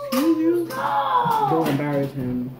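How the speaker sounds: high, very slow sarcastic drawl; mock the kid's words back; mocking sass.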